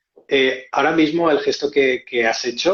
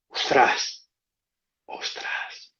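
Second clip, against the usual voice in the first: whispered ostras of amazement; unvoiced, stunned ostras; awed whisper; 6 dB quieter, much slower than usual. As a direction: breathe it out in wonder. Amazed reaction when realising.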